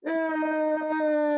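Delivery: humming like a bee; long steady mm hum. Shown as a demonstration. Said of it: "and then".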